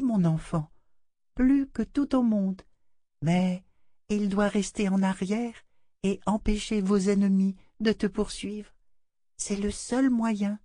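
put-on a doll consoling the child; tender doll voice. A narrator's reading. La poupée console Rachel. (voix de poupée tendre)

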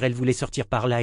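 ordinary delivery, nothing marked

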